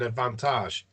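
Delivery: "advantage" the French way, ending "-tahzh"; imitated French pronunciation. Player quoting the Scottish ref's call, French "advantage". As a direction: mimic a referee's French "advantage" with a long ah and soft zh. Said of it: "you don't need to put the accent on it".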